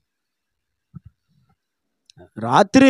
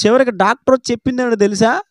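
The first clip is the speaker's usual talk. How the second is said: ordinary talk, nothing marked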